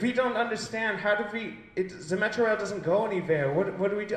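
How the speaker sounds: high-pitched European tourist voice; European tourist accent. Acted. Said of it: "it's the European tourists".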